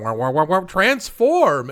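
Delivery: loud mock boings ending high; weird boing effect. Cohost imitating the hero's weird boing as the gangster, loud. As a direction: mimic the silly transformation noise, climbing high on transform. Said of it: "He's doing the weird boing".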